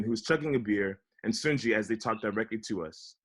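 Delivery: usual speaking voice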